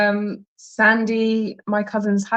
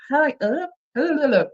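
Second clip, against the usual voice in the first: higher-pitched stumbled hello; garbled greeting; 7 semitones higher than usual. Real, not acted. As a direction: trip playfully over the hellos. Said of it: "How did she spell that?".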